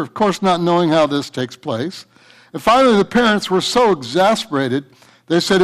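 normal talking voice

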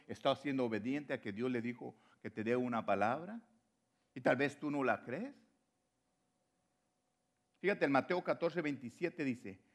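ordinary talk, nothing marked

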